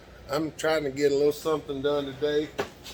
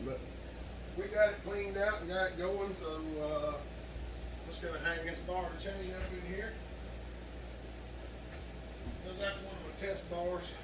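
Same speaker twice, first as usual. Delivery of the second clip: out of breath; breathless from working; gasping for air; 4 semitones higher, 10 dB quieter than usual. Real, not acted. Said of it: "If I sound like I'm out of breath, y'all, I am".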